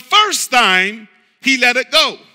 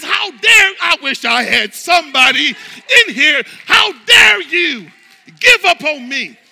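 louder, higher indignant rebuke; indignant Jesus; 5 semitones higher, 7 dB louder than usual. Retold or imitated. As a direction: hurl it as an offended question. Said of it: "he looks at me and he says".

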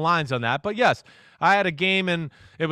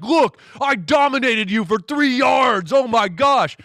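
loud, higher, slow gloating shout; mock taunt; 5 semitones higher, 4 dB louder, slower than usual. Defensive back taunting a receiver, loud slow gloat. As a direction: lord it over him, yelling.

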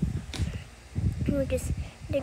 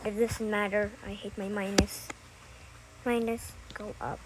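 low, soft monotone; name yourself low and evenly; put-on character voice; 6 semitones lower, 6 dB quieter than usual. Child back in Negative Three role, low. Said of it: "this is just a good voice impression".

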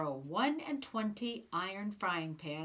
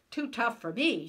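indignant shout, louder; 4 semitones higher, 7 dB louder than usual. Acted. Giant insulted by the wife, indignant shout. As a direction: bristle and bark it back. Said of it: "shouted Cuchulainn".

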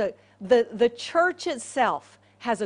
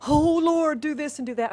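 hammed-up begging to heaven; mock pleading prayer; 4 semitones higher than usual. Preacher parodies believers too busy praying to see, mock-pleading.

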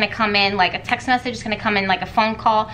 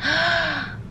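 a quick, real intake of breath; sharp gasp; 6 semitones higher than usual. Real, not acted. Reader feels a surge while holding the card, sharp gasp. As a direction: gasp as the energy hits you.

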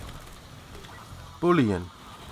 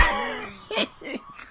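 high nervous laugh; 5 dB quieter than usual. Real, not acted. Laughs after being scared.

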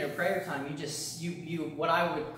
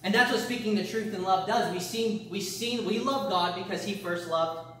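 raised, louder preaching voice; 4 semitones higher, 3 dB louder than usual. Acted. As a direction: preach it out to the church. Preaching with force.

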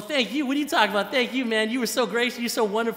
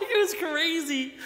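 ordinary delivery

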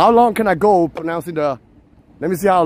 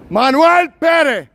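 high, slow, loud name-calling; stretched angry-style street yell; mock-mad scream; 12 semitones higher, 6 dB louder, slower than usual. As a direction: holler it like you're mad at him. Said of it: "when they get mad, they be like".

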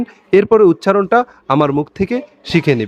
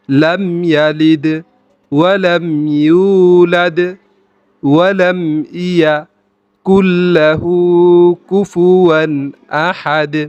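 very slow, word by word with long pauses; slow, segmented recitation for learners; broken up slowly; much slower than usual. Shown as a demonstration. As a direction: break the verse into slow pieces. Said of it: "আমি প্রথমে ভেঙে ভেঙে বলছি".

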